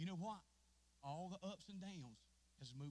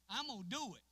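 louder, higher repeat of the vow; preaching shout; 6 semitones higher, 9 dB louder than usual. Acted. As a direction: hit it harder the second time.

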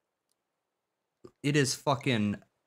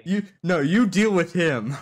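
loud protest; 7 semitones higher, 8 dB louder than usual. Real, not acted. Pushing the problem onto friend.

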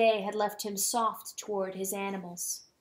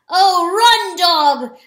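high, loud rooster crow of a shout; 8 semitones higher, 14 dB louder, much slower than usual. Acted. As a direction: crow it out to urge the dog on. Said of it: "the rooster crowed".

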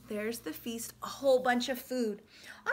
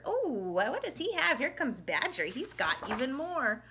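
plain reading voice

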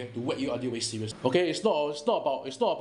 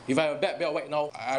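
usual speaking voice

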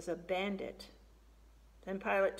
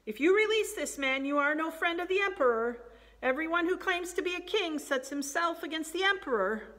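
high, raised-voice protest; crying out; 8 semitones higher, 5 dB louder than usual. A narrator's reading. Crowd pressing Pilate not to release him, crying out. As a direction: call out the warning over a crowd, insistently.

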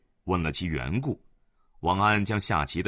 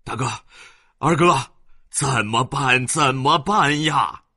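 panting panic; 5 semitones higher, 7 dB louder than usual. Acted. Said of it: "气喘喘的叫将进来".

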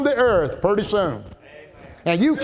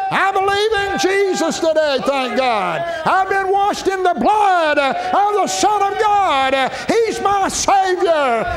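shouting praise; 7 semitones higher than usual. Real, not acted. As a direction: shout it out to the congregation like a revival climax. Preacher bursts into shouted praise.

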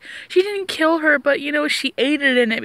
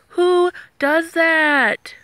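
very slow, stretched-out repeat; drawn-out disbelief; 5 semitones higher, much slower than usual. Real, not acted. Vlogger repeating her outrage at the guard, very slow and drawn out. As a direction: drag it out, still stunned.

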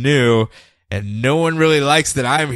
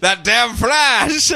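slow, higher mock outrage at the Flash; corrected name in a mock angry rant; mock editor rant; 9 semitones higher, much slower than usual. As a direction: correct it and rant like a cranky newspaper boss.

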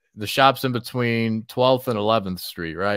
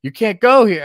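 loud high warning; shout; 12 semitones higher, 10 dB louder than usual. Retold or imitated. Cop blocking him retold.

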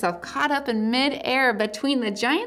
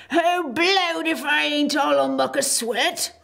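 winded giant voice, a bit higher; puffing giant; 6 semitones higher than usual. A narrator's reading. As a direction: huff it out like an out-of-breath giant. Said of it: "puffing like the largest railway engine".

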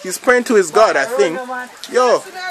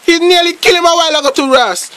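high-pitched, worked-up exclamations; excitement climbing on the sideline; astonished commentary; 6 semitones higher than usual. Real, not acted. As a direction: react in shock to a near thing. Astonished at near miss in play.

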